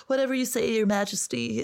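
high, fawning mock courtier voice; hammed-up bowing servant; mock grovelling subject. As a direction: simper it up like a scuttling yes-man. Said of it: "he's like".

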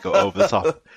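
tickled by the bad impressions; giggling at over-the-top accent caricatures; amused. Real, not acted. Mocking bad Birmingham accents.

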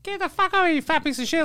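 high-pitched imitation of a cursing boy; mock a rude little kid cussing you out; swearing kid. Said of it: "he was swearing at me too".

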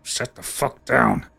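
slow, menacing order to sit; menacing command. Acted. NPC orders the guest to sit, slow and menacing. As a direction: growl it out slowly, like a threat. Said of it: "looks over and goes".

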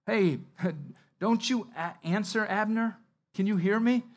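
calling out. A narrator's reading. David calling out to Abner from afar, loud. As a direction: shout across the hill to wake the camp. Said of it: "David called out to the people".

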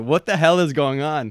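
retold cry of disbelief; exasperated disbelief. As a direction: act out a bewildered protest. Retells her disbelief at the bill.

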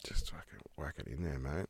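hushed whispered aside; breathy whisper under the breath. Real, not acted. Muttered aside while tasting.

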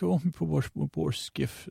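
a hammed-up Irish mourning for his broken boat; mock Irish accent. Grieving ferryman over his wrecked skiff, mock Irish lilt. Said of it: "more like an Irish guy".

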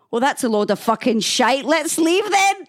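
loud, high Scottish granny swearing; thick Scottish accent. Retold or imitated. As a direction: imitate an indignant Scottish gran. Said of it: "swearing in English with a real thick Scottish accent".

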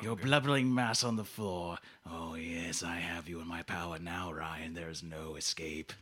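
low, hushed sinister monologue; gloating villain, menacing. Acted. Villain tells Ryan there's no escape, quiet menace. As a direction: savour your power over a helpless captive.

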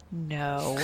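curt, tight-lipped refusal. Acted. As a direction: press the lips and refuse. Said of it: "tightens her lips and is like".

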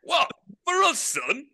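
lightly voiced Yorkshire manager, chuckling; impression of Greg Abbott. Manager on a prank call to a footballer, mock gruff. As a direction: play the gruff manager on the phone.

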